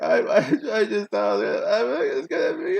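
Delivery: high-pitched blubbering imitation; mock crying. Crying boy explaining himself to mom, mock blubbering. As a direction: sob and stumble through it, hammed-up. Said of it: "but I was crying".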